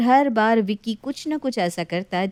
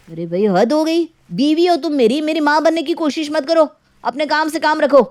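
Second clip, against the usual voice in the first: heated retort; 6 semitones higher, 5 dB louder than usual. Acted. Husband shouting back at wife.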